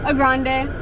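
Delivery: ordinary talk, nothing marked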